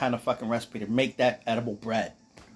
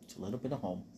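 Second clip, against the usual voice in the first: low hushed voice; 4 semitones lower, 11 dB quieter, much faster than usual. Retold or imitated. Voicing a hobbit's line.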